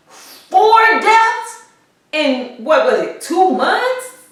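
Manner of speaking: high-pitched, incredulous questioning; retold disbelief. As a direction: let the count shoot up in disbelief. Retold disbelief at four deaths.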